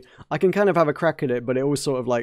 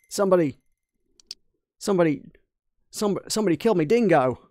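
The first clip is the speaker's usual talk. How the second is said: higher, put-on Australian accent; 5 semitones higher than usual. Mechanic reporting a loss, hammed-up Australian accent. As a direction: stammer it out in broad Aussie. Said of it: "get better at doing Australian".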